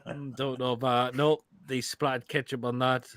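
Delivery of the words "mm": normal talking voice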